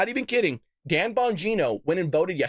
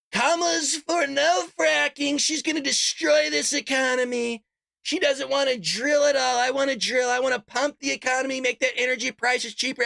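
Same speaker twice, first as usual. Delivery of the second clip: mock Trump; 6 semitones higher than usual. Mocking Trump on the debate stage, higher put-on voice. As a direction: ham up Trump ranting about fracking.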